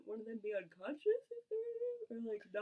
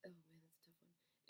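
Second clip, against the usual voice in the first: whispering; 6 semitones lower, 21 dB quieter than usual. Real, not acted. Whispered aside.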